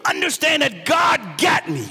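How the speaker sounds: preaching at full force. Acted. Preacher rousing the congregation against the enemy, shouting.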